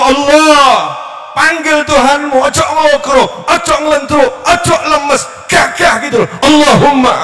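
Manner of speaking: rally them, shout it with fire; fervent shout. Shown as a demonstration. Preacher urging the crowd to pray boldly, shouting. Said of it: "Gerakno hatimu".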